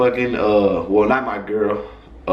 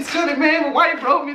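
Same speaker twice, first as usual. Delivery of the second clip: excited quote; 13 semitones higher than usual. Retold or imitated. Cellmate reads letter happily.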